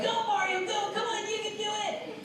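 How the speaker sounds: cheering at Mario. Acted. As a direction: urge on a game character in a small high voice. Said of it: "I'd be like".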